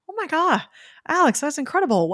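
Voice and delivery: high, loud retold burst of amazement; amazed reaction. Podcaster replays reacting to her friend's dream story, amazed. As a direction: gush at the news, pitch leaping up.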